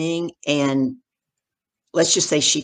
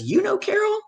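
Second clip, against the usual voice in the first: high-pitched retold surprise; astonished question. Hiring lady questions the man about Carol, astonished. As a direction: squeak it in mock amazement.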